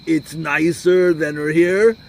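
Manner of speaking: slow, loud, drawn-out imitation of a rant; mock ranting. Questioner repeating herself slowly for a rabbi playing dumb, mock ranting. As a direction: spell it out loudly and very slowly, in mock exasperation. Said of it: "right now she's ranting".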